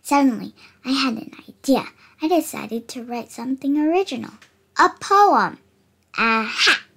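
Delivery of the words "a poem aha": loud, excited discovery; eureka. Acted. Cookie finds a poem idea, bursting with excitement. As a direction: shout it out as it hits you.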